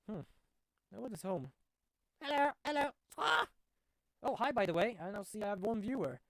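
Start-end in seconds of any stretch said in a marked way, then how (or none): 2.2-3.5 high squawky parrot greeting. Acted. Parrot greeting the visitors, high squawking parrot voice. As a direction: chirp it out like a talking parrot, then squawk. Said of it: "Hey, it's Polly".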